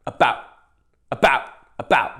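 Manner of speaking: each "About" punched out and stopped short; aggressive, cut-off. Shown as a demonstration. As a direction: explode on "About", then stop dead. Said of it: "you gotta be, like, really aggressive".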